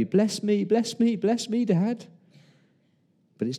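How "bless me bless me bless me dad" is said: mock baby cry, high-pitched pleading; hammed-up childish begging. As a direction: whine it high like a bawling child. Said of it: "crying like a baby".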